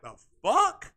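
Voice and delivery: high, loud burst of disbelief; incredulous outburst. Retold or imitated. Podcaster reliving his shock at the quote, incredulous outburst. Reacting in disbelief to what was said.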